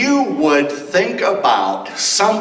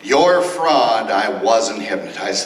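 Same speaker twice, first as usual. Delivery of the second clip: ordinary delivery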